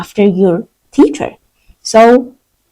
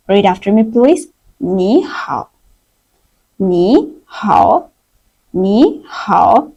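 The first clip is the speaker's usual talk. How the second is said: ordinary talk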